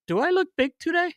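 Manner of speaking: high put-on questioning voice; imitated goalie. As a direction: ask it as the needy goalie, pitched right up. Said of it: "and he'd be like".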